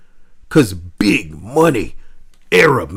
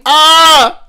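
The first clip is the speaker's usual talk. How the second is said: sudden high startled shout; flustered yelp; 10 dB louder than usual. Real, not acted. Streamer yelps when co-host teases him, loud and high. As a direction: yelp caught off guard.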